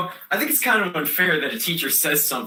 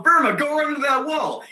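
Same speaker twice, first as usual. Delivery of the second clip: high, raised-voice command; egging on; 8 semitones higher, 4 dB louder than usual. Acted. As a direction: goad a friend into a stunt. Acts out ordering friend into wall.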